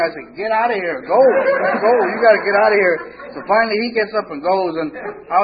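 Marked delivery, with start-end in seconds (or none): 0.3-3.0 loud, high get out; shouted order. Retold or imitated. Ordering the strung-out guy out, shouting. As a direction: push him out with a raised voice.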